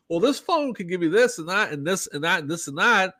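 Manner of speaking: lightly voiced salesman reeling off features; a hint of a pushy phone salesman; mock salesman. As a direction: parody a phone salesman's pitch. Acted phone salesman pitch.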